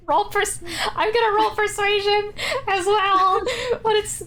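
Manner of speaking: real high voice cracking up; breaking into laughter. Real, not acted. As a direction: get it out through rising laughter. GM breaking up over failed roll.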